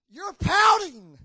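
high, loud, slow shout of a fed-up coach; shouting coach chewing out his players; coach scolding team. Retold or imitated. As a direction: bark at the team like their coach. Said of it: "basketball coach ... was saying to his team".

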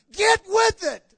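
slow, loud, high "Get with it"; shouted command. Retold or imitated. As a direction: hammer out "Get with it" at full force. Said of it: "God's gonna say".

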